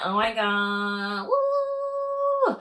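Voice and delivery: sung, then a high squealing woo; excited cheer. Real, not acted. Cheering Brittany's pregnancy, sung and high squeal. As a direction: celebrate like great news just dropped.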